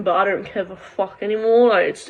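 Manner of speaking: normal talking voice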